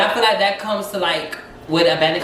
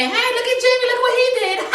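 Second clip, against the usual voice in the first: very high, gushing proud-parent voice; delighted parent. Retold or imitated. Parent showing off son acting girly, gushing and very high. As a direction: coo over the boy like he's adorable. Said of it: "They just gonna say".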